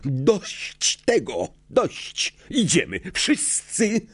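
high, agitated orders in short bursts; mad fury. A narrator's reading. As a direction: cut everyone off, frantic. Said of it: "w oczach Snape'a płonęło szaleństwo". (szaleńcze, wysokie rozkazy)

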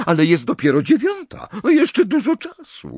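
high, loud motherly voice; patient reassurance. A narrator's reading. Pani Weasley tells Hermiona it is early, patiently reassuring. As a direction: insistently reassure an anxious girl. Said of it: "odpowiedziała cierpliwie pani Weasley".

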